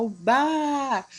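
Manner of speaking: "bar" held very long; long-drawn "o bar"; stretched syllable. Shown as a demonstration. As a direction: sustain "bar" to show where the stress falls. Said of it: "O bar se estende".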